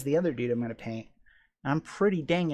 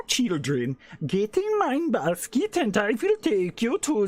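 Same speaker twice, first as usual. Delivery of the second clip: put-on higher, slow Swedish-accented Krampus; 8 semitones higher, 4 dB louder than usual. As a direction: lure the kids with a sing-song Swedish accent. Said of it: "More Swedish sounding".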